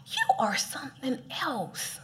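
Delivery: soft whispered aside as God; a slow tender whisper; fond whisper. Retold or imitated. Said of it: "he's like".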